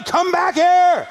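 furious shout chasing the car; angry yelling. Retold or imitated.

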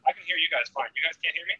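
distant, muffled mic pickup; talking from across the room; far from mic. Real, not acted. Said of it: "It sounds like you're far away".

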